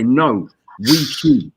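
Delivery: slow, firm no, we queue; mock-stern Brit setting people straight; stern correction. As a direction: lay down the rule to a queue-jumper. Scolding people who skip queues.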